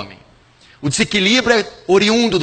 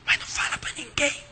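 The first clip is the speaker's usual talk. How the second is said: whisper; 6 semitones higher, 11 dB quieter, faster than usual. Acted. Secret confession, begging for silence.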